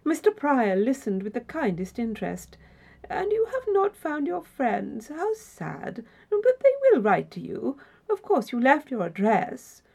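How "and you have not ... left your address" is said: put-on high-pitched, sympathetic old gentleman; solicitous questioning in a raised voice; kindly concern, pitched well up; kindly interested host. A narrator's reading. Host responds with kind interest.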